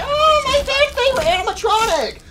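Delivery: hammed-up whine of a hurt fan; mocking upset fans. Mocking fans upset over Foxy, in a high wail. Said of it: "they're gonna be like".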